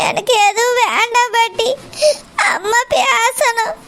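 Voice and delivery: slow, tearful refusal; words pushed out between sobs; crying. Acted. Granddaughter tells her grandmother she only wants her mother, crying.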